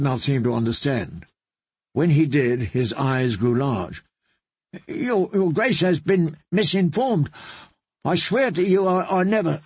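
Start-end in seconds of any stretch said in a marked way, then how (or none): 4.9-9.6 frightened bard. Acted. Bard pleading with the queen.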